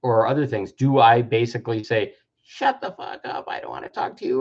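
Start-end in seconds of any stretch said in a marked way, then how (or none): 2.4-4.4 hushed, mostly voiceless snap; angry dismissal kept under the breath; angry brush-off. Pretend. Triggered person shutting the other out, angry and hushed. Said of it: "or I feel angry".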